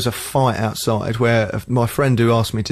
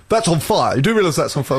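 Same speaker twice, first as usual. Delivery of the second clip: alarmed warning; 6 semitones higher than usual. Retold or imitated. Alarmed people reporting a fire.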